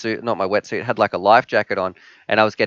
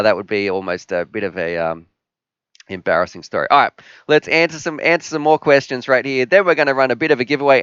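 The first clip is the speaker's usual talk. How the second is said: normal talking voice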